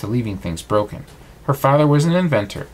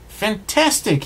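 high, thrilled cry; excited exclaim; 9 semitones higher, slower than usual. A narrator's reading. Cogsworth seeing the fixed bell, high and thrilled. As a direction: leap up with joy on the word. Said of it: "he exclaimed".

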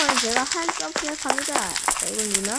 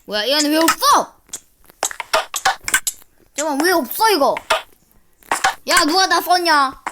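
loud, high annoyed outburst; annoyed shouting; 3 semitones higher, 7 dB louder than usual. Real, not acted. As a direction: demand who used it all. Items missing, upset shouting.

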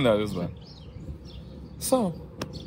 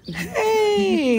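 very high, drawn-out hello; cooing dog greeting. Real, not acted. Podcaster spotting a dog mid-recording, high cooing call. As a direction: squeal a greeting at a cute dog.

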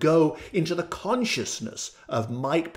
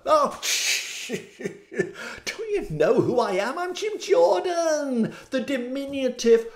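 cocky Jim Jordan; 8 semitones higher than usual. Retold or imitated. Mocking Jim Jordan bragging to an unseen voice. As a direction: puff up and brag, laughing. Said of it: "He didn't want to know".

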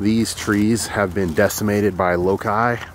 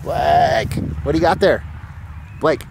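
calling out; 7 semitones higher, slower than usual. Real, not acted. Calling out to child.

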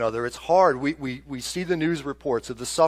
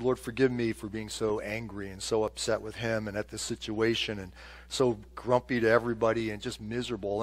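contrite prayer; 4 semitones lower, 8 dB quieter than usual. Retold or imitated.

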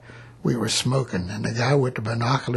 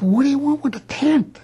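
high, incredulous squeak of disbelief; 4 dB louder than usual. Retold or imitated. Soldier scoffing at green recruits pitching a tent, high incredulous voice. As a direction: act baffled that anyone would want a tent.

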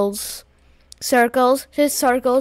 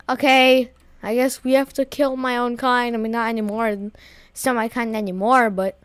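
ordinary talk

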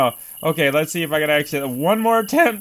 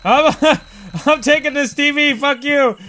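laughing taunt; 7 semitones higher than usual. Real, not acted. Gleeful taunting while stealing TV.

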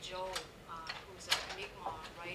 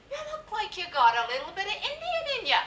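loud, high-pitched neighbour impersonation; Newfoundlander neighbour; 18 dB louder than usual. Retold or imitated. Neighbour sizing up Rita Joe, loud high imitated voice. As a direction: belt it out high as the nosy neighbour. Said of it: "She was a Newfoundlander".

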